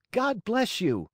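very high-pitched puppet blessing; high childlike character voice; little puppet's voice. A narrator's reading. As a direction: pipe it up like a small puppet. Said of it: "said Pinocchio".

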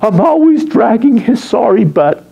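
whiny, put-on girl complaining; mock helpless complaint; exasperated girlfriend. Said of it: "a lot of girls are saying".

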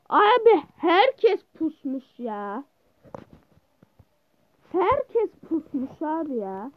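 drawn-out whining complaint; slow complaint. Real, not acted. Gamer complaining everyone is camping, slow whine. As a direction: drag the words, fed up.

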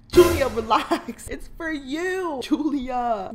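slow mock-tender coo. Gamer cooing at his pixel wife, slow and mock-tender. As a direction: coo it slowly to a pretend wife.

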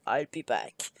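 very low-pitched movie-quote voice; deep parody one-liner; mock action-hero threat. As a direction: drop deep for the catchphrase. Terminator-like quote after the narrated threat.

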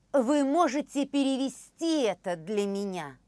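ordinary talk, nothing marked

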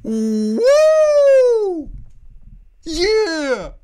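high loud whoop; excited cheer. Real, not acted. Fan cheering the scene to viewers, loud. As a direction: cheer like the team scored.

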